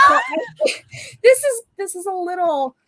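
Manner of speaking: high-pitched, helpless laughter through the words; real giggles breaking up the sentence; can't stop laughing. Real, not acted. Guest starting a funny story about art school, laughing. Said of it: "I don't say this without laughing".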